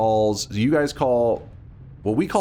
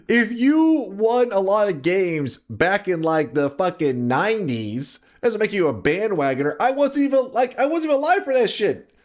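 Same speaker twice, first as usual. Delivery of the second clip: indignant outburst; 8 semitones higher than usual. Real, not acted. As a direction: fire back at the chat, offended. Defensive outburst at chat.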